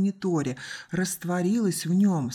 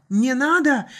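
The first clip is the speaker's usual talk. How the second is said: high, loud plea; boy's desperate outcry; crying out; 8 semitones higher, 7 dB louder than usual. A narrator's reading. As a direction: shout it in panic. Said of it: "выкрикнул Артур".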